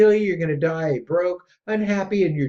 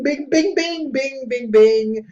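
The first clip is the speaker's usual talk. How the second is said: mock bing sounds; 8 semitones higher, 4 dB louder, slower than usual. Mimicking impulse buying sounds.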